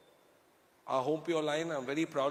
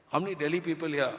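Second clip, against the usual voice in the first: usual speaking voice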